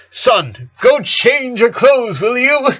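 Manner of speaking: a cheerful order with no room to refuse; insistent dad. Acted. Dad telling Lincoln to go change, high and firm.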